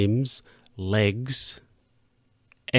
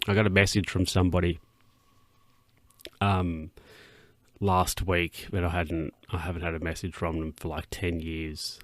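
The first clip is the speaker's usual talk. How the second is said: ordinary talk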